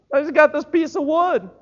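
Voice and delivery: high, loud, protesting Moses; reluctant Moses. Acted. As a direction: plead your way out of the call. Moses voiced.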